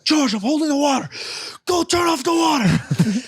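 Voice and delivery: frantic dad shouting orders; dad's urgent shout. Retold or imitated. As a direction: bellow the order over rushing water. Dad yelling during pipe burst.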